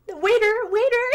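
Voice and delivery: loud, high call of waiter; hammed-up shout for service; mock call for the waiter. Pit bull calling the waiter.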